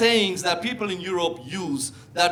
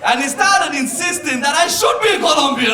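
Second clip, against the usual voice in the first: usual speaking voice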